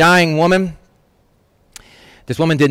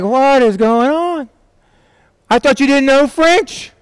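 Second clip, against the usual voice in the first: high, drawn-out amazement; astonished onlooker; 10 semitones higher, slower than usual. Retold or imitated. Bystander baffled by the woman talking in French, astonished. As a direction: act out stunned disbelief in a high voice.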